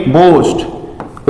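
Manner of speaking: बोस्ट slowly, with a long o; teacher's drawn-out बोस्ट with long o. Shown as a demonstration. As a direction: stretch the o in बोस्ट. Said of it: "long sound निकलेगा".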